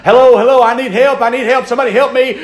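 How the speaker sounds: high, loud desperate call for help; panicked radio call. Retold or imitated. Hunter to the radio, panicked loud cry for help. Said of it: "fumbles through it and says".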